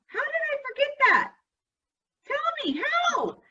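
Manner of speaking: mock disbelief. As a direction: ham up the shock at forgetting. Teacher mock-outraged at herself.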